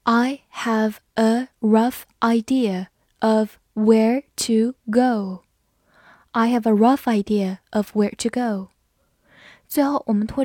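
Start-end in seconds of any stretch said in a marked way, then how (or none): 0.0-5.4 slow, word-by-word model reading. Shown as a demonstration. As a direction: read it slowly, pausing between each word. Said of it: "慢读一遍".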